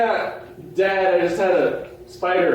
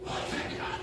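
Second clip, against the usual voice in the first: relieved whisper; 14 dB quieter than usual. Acted. Relief after ghosts vanish.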